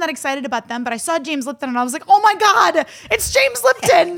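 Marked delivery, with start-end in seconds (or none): 2.1-4.2 loud, high-pitched fan squeal in a retelling; starstruck outburst. Retold or imitated. Retelling spotting a TV host to friends, starstruck shout. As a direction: blurt it out like a thrilled teenager.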